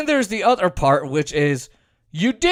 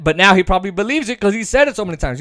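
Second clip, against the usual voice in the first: usual speaking voice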